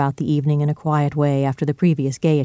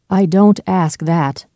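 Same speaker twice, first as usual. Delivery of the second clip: slow, raised, earnest plea; drawn-out, emphatic appeal; pleading; 4 semitones higher, 5 dB louder, slower than usual. A narrator's reading. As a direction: plead with him, louder and higher. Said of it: "pleaded Jekyll".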